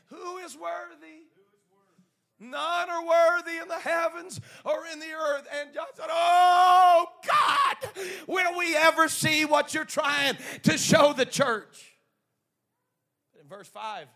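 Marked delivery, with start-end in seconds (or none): 0.1-5.5 slow high call swelling into a loud shout; angelic proclamation. Acted. Angels announce that none are worthy, swelling proclamation. 6.1-12.0 loud, high, drawn-out wail to heaven; anguished cry. Acted. Preacher as John begs God for the vision, anguished shout.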